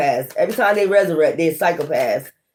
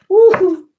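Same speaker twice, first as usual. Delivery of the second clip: a high, louder whoop through laughter; laughing woo; 12 semitones higher, 4 dB louder than usual. Real, not acted. Tarot reader losing it at the cards, whooping with laughter. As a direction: whoop it out in a fit of giggles.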